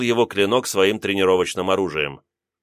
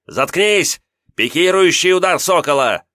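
furious yell, much higher; angry shout; 8 semitones higher, 5 dB louder than usual. Acted. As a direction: bark it out in rage. Angry youth yells while attacking.

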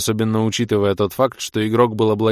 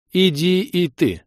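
commanding goddess; 10 semitones higher, 4 dB louder, slower than usual. A narrator's reading.

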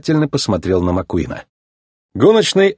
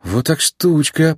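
slow, higher, smitten murmur; awestruck murmur; 5 semitones higher, slower than usual. A narrator's reading. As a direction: murmur slowly, struck by her beauty. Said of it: "пробормотал Маккуин, поражённый великолепием голубой Порше".

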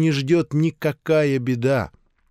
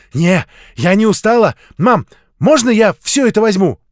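put-on high, loud pleading little girl; raised, childlike eagerness; eager child; 7 semitones higher, 8 dB louder than usual. A narrator's reading. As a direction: beg Mum like an excited kid. Child eagerly begs her mother.

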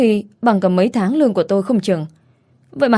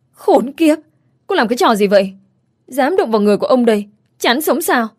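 startled angry outburst; 4 semitones higher than usual. Acted. Customer pinned by the shop girl, shouting high in shock. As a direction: yelp then threaten her fast. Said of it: "thốt lên".

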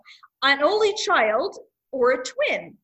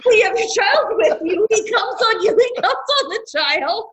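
laughing shout; 7 semitones higher, 4 dB louder than usual. Real, not acted. Cracking up at absurd ad.

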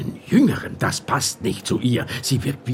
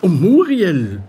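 louder, drawn-out pleading; pleading emphasis; 4 semitones higher, 5 dB louder, slower than usual. Acted. A distressed friend begging the inspector for help, loud and pleading. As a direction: press the name urgently.

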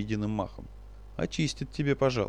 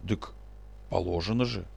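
low, thrown off, unsure; flustered; 4 semitones lower, 4 dB quieter than usual. Acted. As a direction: stammer it out, caught off guard. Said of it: "Тот растерялся".

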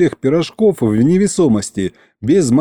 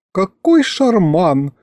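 put-on slow, higher, dreamy girl's voice; dreamy sigh; 6 semitones higher, slower than usual. Masha floating, delighted and sighing. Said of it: "вздохнула Маша".

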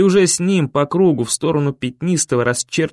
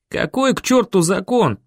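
higher, angry yell; yelling in rage; 6 semitones higher than usual. A narrator's reading. Boy losing his temper at the elders, yelling. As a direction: lose your temper and yell. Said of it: "заорал я, выходя из себя".

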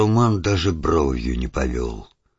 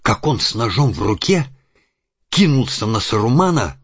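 rising tension; 7 semitones higher, 3 dB louder than usual. A narrator's reading. Narration of sudden murder.

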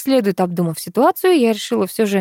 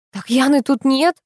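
raised, higher-pitched protest; agitated, higher exclamation; mild shouting; 5 semitones higher than usual. A narrator's reading. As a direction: cry out, stopping short of a full shout. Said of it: "закричала я".

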